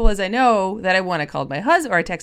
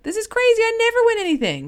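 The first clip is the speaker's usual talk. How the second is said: high, fast shocked squeal of disbelief; disbelieving winner; 3 dB louder, faster than usual. Retold or imitated. A prize winner blurting out shock, high and fast. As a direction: gush like someone stunned to have won. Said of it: "you will typically hear out of their mouths".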